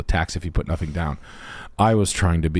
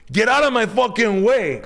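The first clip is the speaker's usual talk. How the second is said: mock angry girl; 14 semitones higher than usual. The girl in the club yelling at the buddy. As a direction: snap at him like the girl in the club. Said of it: "And she goes".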